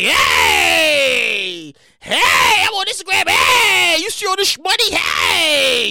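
hammed-up flexing for Instagram; mock yelling to flaunt money; loud show-off. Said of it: "You can't be out here like".

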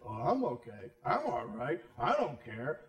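low, smug mock voice; deluded fool. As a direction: drop the voice low and shrug it off. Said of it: "this sense of deception".

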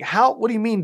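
mock exasperated comeback to the coach; frustrated runner. Frustrated runner thinking back at his coach, exasperated.

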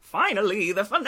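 high, loud finale announcement; excited cheer. Real, not acted. Co-hosts greeting the long-awaited finale, excited cheer. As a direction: cry it out in celebration.

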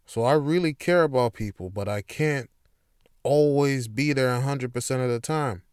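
normal talking voice

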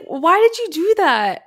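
high, loud drawn-out question; acted-out indignant alarm; alarmed protest. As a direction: demand a reason, freaked out. Retelling alarmed challenge to sleepwalking husband.

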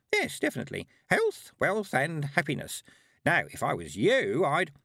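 put-on high-pitched, chatty old woman; old lady. A narrator's reading. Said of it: "said the old lady".

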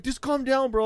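high-pitched mock plea; imitated pleading rider. Rider answering driver's threat to walk, mock pleading. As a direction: mimic a passenger trying to placate.

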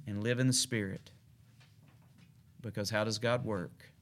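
soft closing exhortation. Real, not acted.

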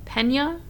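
questioning "PEN-yuh" for Pena; accented N, questioning. Shown as a demonstration. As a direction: try Pena with an eh vowel and ny sound. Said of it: "there's an accent on that N".

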